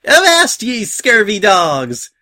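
high, loud holler, drawn out at the end; crazed pirate shout. A narrator's reading. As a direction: shout it like a crazy pirate captain. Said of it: "the crazy pink mare shouted".

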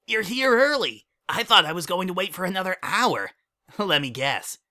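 put-on high, bouncy kid voice; young colt voice. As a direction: play an eager little colt. Child character voice.

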